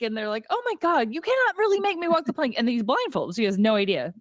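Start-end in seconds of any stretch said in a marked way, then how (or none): 0.5-2.5 much higher, fast, alarmed pleading; panicked protest. Retold or imitated. Blindfolded XO protests to the pirate crew, panicked. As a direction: blurt it out in a rising panic.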